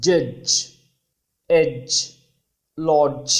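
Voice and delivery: "జడ్జ్, ఎడ్జ్, లాడ్జ్" with a firm J; each word alone, hard J made of D plus zh; combined D-plus-J sound. Shown as a demonstration. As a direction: demonstrate the full affricate in each word. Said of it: "combine అయిన sound జా".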